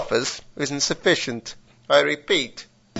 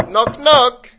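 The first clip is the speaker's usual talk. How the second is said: loud knock knock; 7 semitones higher, 7 dB louder than usual. Acted. Knock-knock joke at door.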